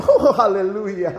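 laughing, hammed-up churchy exclamation; mock Sunday piety. Preacher mocks churchgoers' Sunday talk with a laughing hallelujah. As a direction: ham up a holy-Sunday hallelujah. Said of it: "kalau Minggu tuh bahasanya juga".

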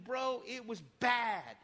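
usual speaking voice

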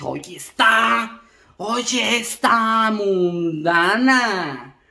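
drawn-out, very slow mock judgement; slow gossipy verdict. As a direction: stretch out the words like a scandalized gossip. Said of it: "Y yo dije". (lento y chismoso)

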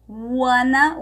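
"Wanna" for want to, two quick syllables; wanna reduction. Shown as a demonstration. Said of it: "Em inglês a gente pode falar wanna".

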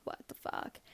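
unvoiced shocked whisper; whispered panic. Waking in a stranger's bed, whispered shock. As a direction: whisper it in alarm.

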